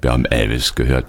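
ordinary delivery

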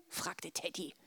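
usual speaking voice

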